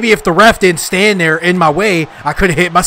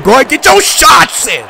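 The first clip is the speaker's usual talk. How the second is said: raised, higher-pitched outburst; exasperated taunt; 6 semitones higher than usual. Real, not acted. Player daring the wrestler to keep hitting, exasperated. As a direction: call it out to the opponent, voice jumping up. Fed-up taunt at game opponent.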